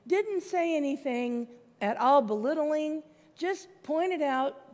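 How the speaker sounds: usual speaking voice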